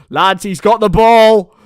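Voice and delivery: loud, high, drawn-out exclamation; excited hype. Real, not acted. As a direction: stretch it out loudly to the lads. Mock hype over favourite player.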